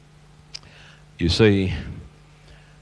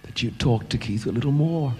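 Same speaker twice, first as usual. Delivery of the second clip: soft, raised voice of a grieving widow; retold tearful regret; 4 semitones higher, 5 dB quieter than usual. Widow to her pastor after the accident, hushed regret. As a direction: gently voice her regret, high and quiet. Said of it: "And she was crying".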